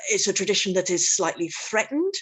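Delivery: normal talking voice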